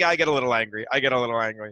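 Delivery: lightly voiced low mumble imitation; imitating Luis. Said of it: "He's like".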